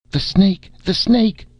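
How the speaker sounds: high panicked scream of warning; terrified scream. Acted. Marsha screaming at the crew as the snake comes, terrified. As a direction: scream it in terror, pitch way up. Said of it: "screamed Marsha".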